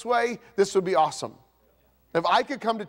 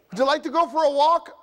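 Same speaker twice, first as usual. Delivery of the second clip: high, light coaxing wife; wife nudging her grumpy husband, pitched high; wife asking; 7 semitones higher, 4 dB louder than usual. Retold or imitated. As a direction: coax a sulking husband gently. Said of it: "your wife says".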